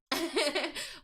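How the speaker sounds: short high giggle; amused chuckle. Real, not acted. Streamer amused by a viewer's termite comment, chuckling. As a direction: break into a brief, high laugh.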